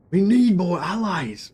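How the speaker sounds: a quick failed stab at a brooding northern hero; Jon Snow voice. Retold or imitated. Streamer tries Jon Snow for chat, gives up. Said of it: "I can't do the Jon Snow voice".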